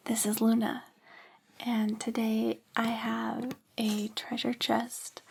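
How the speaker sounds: normal talking voice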